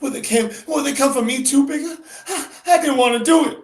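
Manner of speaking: put-on high, fearful young woman's voice; panicked girlfriend begging for reassurance; frightened Bessie. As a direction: Bessie pleading in fear with Bigger. Teacher voices Bessie in fear.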